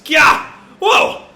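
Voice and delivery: sharp, high, loud startled cries; startled yelps. Acted. Voicing the character's fright at the demon face, high yelps. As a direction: jump back in surprise.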